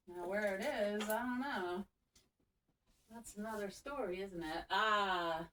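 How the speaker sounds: soft aside while looking for a wand; quiet muttering. Real, not acted. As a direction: drift off as you search. Searching for wand, voice drops.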